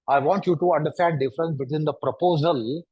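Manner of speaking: usual speaking voice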